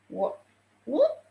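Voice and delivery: sharp rising what; puzzled, sudden high what; surprised what. Real, not acted. Puzzled by phone not turning.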